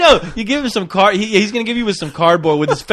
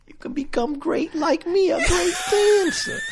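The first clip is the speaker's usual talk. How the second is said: mock commercial voice; 11 semitones higher, 5 dB quieter than usual. Mocks the pitch.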